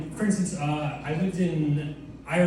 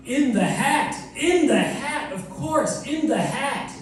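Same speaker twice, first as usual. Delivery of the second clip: high, rising excited realization; Doctor Seuss breakthrough; 9 semitones higher, 5 dB louder, slower than usual. Acted. Author struck by a breakthrough idea, high and elated. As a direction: land each repeat like a sudden discovery. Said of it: "That's Doctor Seuss having a breakthrough".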